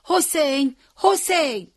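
high, drawn name-calling; searching shout, pitched far up; loud cry. A narrator's reading. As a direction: call out for a missing friend. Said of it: "بلند فریاد زد".

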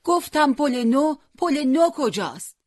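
high, loud, slow repeat for someone hard of hearing; shouting. A narrator's reading. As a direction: yell the question, drawing out each word. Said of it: "حسین هم داد زد".

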